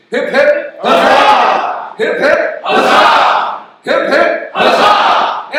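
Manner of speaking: loud high hip hip huzzah; Civil War cheer. Real, not acted. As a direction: lead the crowd in a rousing cheer. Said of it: "We say".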